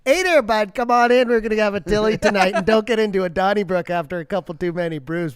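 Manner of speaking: bold, folksy Canadian bro voice, lots of "bud" and "brews"; hoser Canadian accent. Retold or imitated. Welcoming guests into the studio in a thick folksy Canadian accent. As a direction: play the friendly Alberta bar regular. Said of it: "that kinda".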